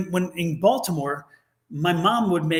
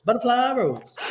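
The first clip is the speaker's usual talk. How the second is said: drawled Southern; 6 semitones higher, slower than usual. Retold or imitated. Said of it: "one of those Southern things".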